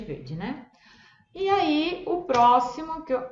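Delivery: ordinary talk, nothing marked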